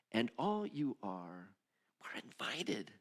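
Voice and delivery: soft unvoiced whisper. Preacher whispering the invitation to the congregation. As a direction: lean in and whisper it.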